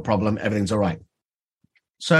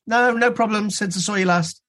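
patient brushing it off; 8 semitones higher than usual. Retold or imitated. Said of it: "And the patient would go".

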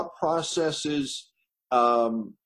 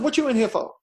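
higher, streetwise cellmate asking; Buck's voice; 9 semitones higher, faster than usual. Retold or imitated. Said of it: "you hear it like it was".

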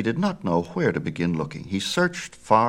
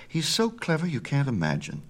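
proud mother, pitched up; 8 semitones higher than usual. A narrator's reading. Maia boasts of her baby to Apollo, proudly. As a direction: gush a little over your child. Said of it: "said Maia proudly".

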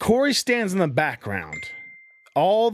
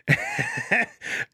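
high laugh; 3 dB quieter than usual. Real, not acted. Laughs at being dropped.

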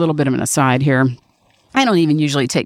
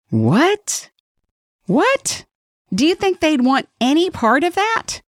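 mock disbelief; 10 semitones higher, slower than usual. Mocking drug companies' reaction.

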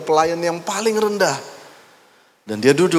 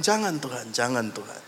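softer pleading; 8 dB quieter than usual. Acted. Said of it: "dia bilang".

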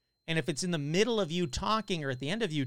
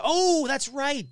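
sudden recollection; 9 semitones higher, 6 dB louder, much slower than usual. Real, not acted. Suddenly remembers listener's story.

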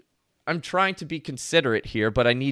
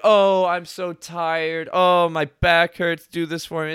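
slow, louder, hammed-up weary groaning; mock tired guilt trip; 4 semitones higher, 5 dB louder, slower than usual. Milk the tiredness, slowly. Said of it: "saying, like".

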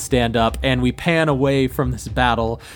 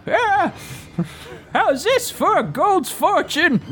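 put-on high creaky old man voice; gleeful old prospector mage. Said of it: "this old prospector mage".

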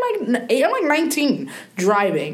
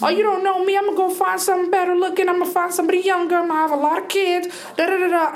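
high, rapid mock husband's threats; taunting husband; 13 semitones higher, faster than usual. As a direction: rattle off his threats in a high sing-song imitation. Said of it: "It was your way of telling her".

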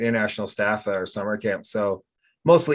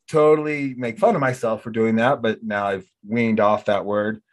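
normal talking voice

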